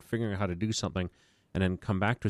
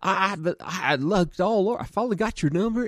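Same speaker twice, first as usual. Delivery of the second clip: put-on Louisiana accent; 12 semitones higher, 6 dB louder than usual. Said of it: "He had this Louisiana accent".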